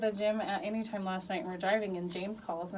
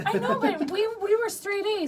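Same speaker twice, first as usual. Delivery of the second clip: higher and louder, laughing; excited outrage; 12 semitones higher, 8 dB louder than usual. Real, not acted. Mother protesting to hosts, excited and loud.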